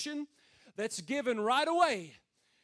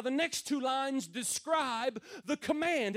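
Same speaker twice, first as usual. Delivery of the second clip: ordinary delivery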